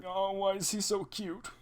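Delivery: a fan melting over a cute robot, cooing high and soft; cooing over cuteness. Acted. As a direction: coo like seeing a puppy. Gushing over cute Frobo.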